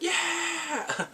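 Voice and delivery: high celebratory yeah; happy cheer. Retold or imitated. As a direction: cheer with joy.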